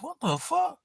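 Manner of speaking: soft, very high-pitched disbelief; baffled reaction. Retold or imitated. Streamer replays his reaction to the troll, baffled. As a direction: squeak it out quietly, as retold bafflement.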